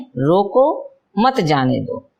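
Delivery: रोको lifted and set apart, then मत जाने दो falling; pause after रोको. Shown as a demonstration. Teacher repeating the comma example for children, break after रोको. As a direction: split off rising रोको from मत जाने दो. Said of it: "रोको के बाद अल्प विराम है".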